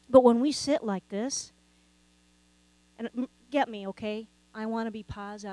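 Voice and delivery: ordinary talk, nothing marked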